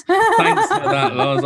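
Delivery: high, slow, laughing sarcastic thanks. Real, not acted. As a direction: laugh it out in mock gratitude. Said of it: "sarcastic laughing thanks".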